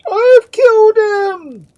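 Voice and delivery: very high, loud, long cry; piercing shriek. A narrator's reading. Duke repeating his confession, piercing shriek. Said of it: "with still more piercing shriek".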